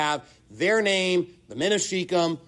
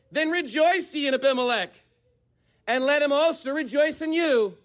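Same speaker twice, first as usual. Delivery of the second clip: high-pitched mock invitation to celebrate; sarcastic; 7 semitones higher than usual. Preacher reading out Jotham's rebuke to Shechem, sarcastic. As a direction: read it with lofty, put-on gladness. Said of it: "This is sarcasm, folks".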